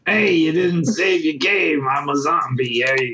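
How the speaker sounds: slow, put-on Brooklyn accent; lightly voiced Brooklyn zombie. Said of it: "You just give him, like, a Brooklyn accent".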